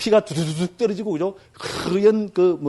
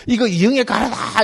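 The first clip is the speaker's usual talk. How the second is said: high-pitched show-off announcement; mock excited boast; 5 semitones higher than usual. Paparazzo shows off actress's phlegm, mock excitement. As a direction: present it like a prized find, hammed up.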